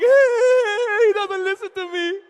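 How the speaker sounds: high, loud mock wail; hammed-up wailing complaint, easing toward the end; wailing girl. Girl two years into marriage complains about husband, mock wailing. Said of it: "the girl's like".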